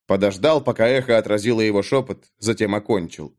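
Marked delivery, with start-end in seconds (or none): none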